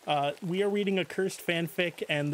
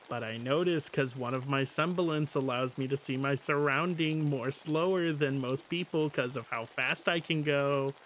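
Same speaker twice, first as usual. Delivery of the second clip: usual speaking voice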